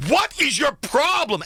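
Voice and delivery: high, slow indignant yell at the other driver; angry driver. Retold or imitated. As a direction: demand it, outraged. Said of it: "and you're like".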